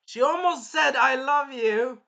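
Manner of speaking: high, loud excited cry; worked-up exclamation. Real, not acted. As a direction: blurt it loudly, stretching the end. Worked up over near confession.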